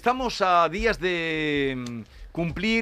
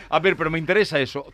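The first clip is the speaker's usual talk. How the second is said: ordinary talk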